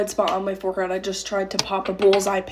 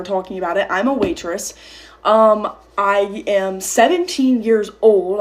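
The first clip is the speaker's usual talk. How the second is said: ordinary talk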